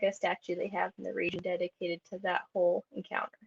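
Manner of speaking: usual speaking voice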